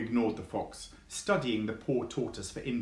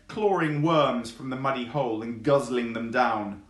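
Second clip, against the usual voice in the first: ordinary delivery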